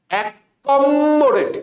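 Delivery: অ্যাকমোডেট drawn out slowly, stressing the double letters; slow spelling pronunciation. Shown as a demonstration. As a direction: spell it out slowly as ak-kom-mo-date. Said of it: "এইভাবে উচ্চারণ করো".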